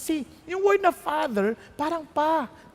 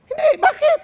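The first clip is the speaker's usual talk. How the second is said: sudden, much higher and louder outburst; exclaimed; 12 semitones higher, 6 dB louder than usual. Real, not acted. Preacher answering his own question to the congregation, exclaiming. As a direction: burst out with the answer.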